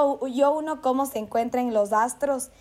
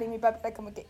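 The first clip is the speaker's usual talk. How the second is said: ordinary delivery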